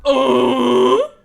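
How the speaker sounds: loud startled exclamation. Real, not acted. Reading scores aloud, loud startled oh. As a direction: shout out in surprise.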